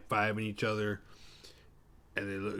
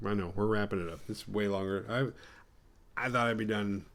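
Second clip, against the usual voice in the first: usual speaking voice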